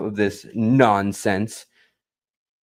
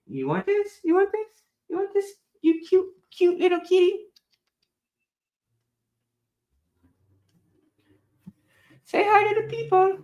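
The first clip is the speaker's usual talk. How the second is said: very high pet voice; high, teasing coo to a cat. Real, not acted. Owner teases the cat with toy, baby talk.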